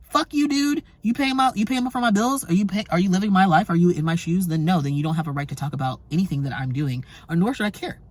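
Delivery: indignant clapback; defiant retort. Retold or imitated. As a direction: tell the critics off. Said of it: "I'm like".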